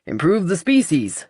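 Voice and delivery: lightly pompous take on "Improve the species"; mock the man's grand phrase; mocking quote. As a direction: [name] teasing Edgar's words. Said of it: "It kind of is".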